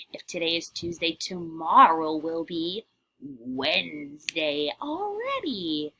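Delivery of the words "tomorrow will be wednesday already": ordinary talk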